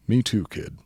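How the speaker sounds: ordinary talk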